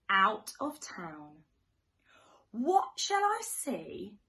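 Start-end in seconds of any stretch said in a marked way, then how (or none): none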